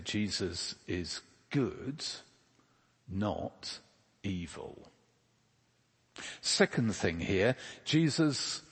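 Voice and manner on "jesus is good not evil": each word slow and firmly placed; clear and deliberate. Shown as a demonstration. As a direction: say it clearly, weighing every word. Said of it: "clearly and deliberately say".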